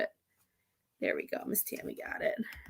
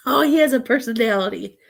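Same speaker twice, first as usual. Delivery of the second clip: ordinary delivery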